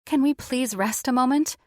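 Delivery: high, pleading request; imploring. A narrator's reading. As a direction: beg gently for a break. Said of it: "Tatiana implored".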